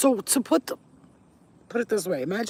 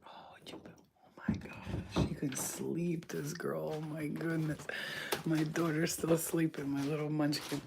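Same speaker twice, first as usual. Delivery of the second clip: very soft, slow half-whisper; hushed; 2 semitones lower, 10 dB quieter, slower than usual. Real, not acted. Hushed beside her sleeping daughter.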